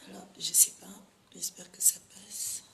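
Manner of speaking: unsure whisper to herself. Real, not acted. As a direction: whisper as if no one hears. Muttering to herself while testing.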